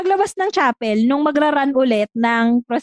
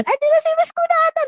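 high shouted alarm; retold shout; 14 semitones higher than usual. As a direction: yell the warning like an alarmed child. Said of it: "dahil sumigaw ako ng ganun".

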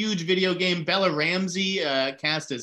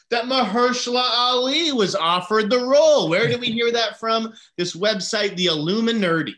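higher, louder ranting delivery; worked-up rant; 7 semitones higher, 5 dB louder than usual. Real, not acted. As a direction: launch into an indignant rant. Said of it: "started on a rant".

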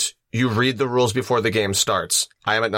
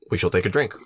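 usual speaking voice

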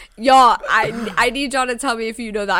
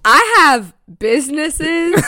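slow, loud, high comic boast; Joanne the scammer; 5 semitones higher, 7 dB louder, slower than usual. Acted. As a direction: proclaim it like a self-important scammer. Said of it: "Joanne the scammer, if you will".

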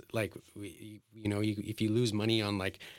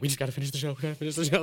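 higher, frantic repeating; freaking out; 6 semitones higher, 3 dB louder, much faster than usual. Retold or imitated. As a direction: re-enact panicked determination to get through it.

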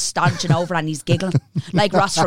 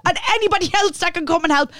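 much higher, mock-hysterical plea for an ambulance; hammed-up panic; 12 semitones higher than usual. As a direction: overact the victim, laughing through it. Imagined hysterical overreaction.

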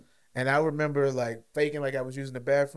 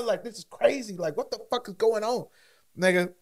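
ordinary talk, nothing marked

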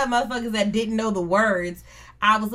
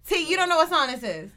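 normal talking voice

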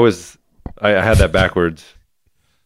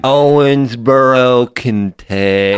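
drawn-out place name; 3 semitones higher, much slower than usual. Acted. Spelling out Depp's birthplace.

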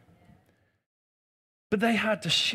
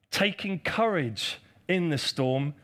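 usual speaking voice

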